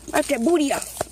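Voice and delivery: usual speaking voice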